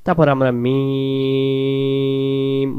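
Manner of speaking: মিম hummed long with lips closed; nasal ghunnah. Shown as a demonstration. Teacher demonstrating ghunnah on মিম. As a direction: hold the মিম in a nasal hum. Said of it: "মিমে তাসদিদে আমরা গুণ আদায় করব".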